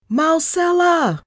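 high, loud, drawn-out scream. A narrator's reading. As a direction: scream the name for help. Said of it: "I screamed".

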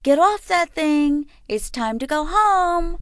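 high, loud scolding call. A narrator's reading. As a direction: call her off the ride sharply. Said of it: "call out".

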